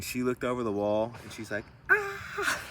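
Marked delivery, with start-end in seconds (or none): 1.9-2.6 short, very high mock shriek; imitated frightened scream. His companion looking over the wall, mock scared scream. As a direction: squeal as if startled, without full volume. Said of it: "this lady got so scared".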